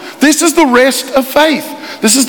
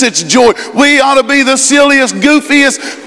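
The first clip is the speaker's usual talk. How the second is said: ordinary talk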